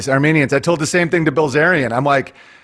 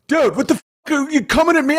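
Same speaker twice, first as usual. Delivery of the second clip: high, mocking voice of an offended reader; hammed-up defensive bro, taking offense; mock offended reply; 9 semitones higher than usual. Mocking a reader who feels attacked, high and defensive. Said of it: "people will be like".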